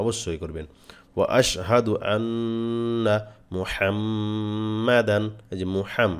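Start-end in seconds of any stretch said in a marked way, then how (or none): 1.1-5.2 slow chant, ন্ন and ম্ম held nasal; ghunnah recitation. Shown as a demonstration. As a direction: hold the nasals in আন্না and মুহাম্মাদান. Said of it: "মিমে ওয়াজিব গুণা করবেন". (গুন্নাহসহ ধীরে পড়া)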